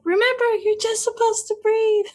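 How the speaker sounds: put-on high child's voice reminding mom; kid voice. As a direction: chirp it like a small boy. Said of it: "And they're like".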